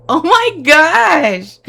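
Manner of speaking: real joy close to tears; overwhelmed excitement. Real, not acted. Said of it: "I wanna cry".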